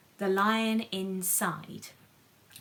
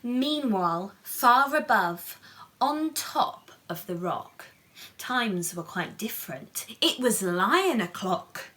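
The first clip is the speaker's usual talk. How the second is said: storybook narration; 3 semitones higher, 5 dB louder, slower than usual. A narrator's reading. Reading the picture book aloud to children, animated. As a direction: lift the story up for the little ones.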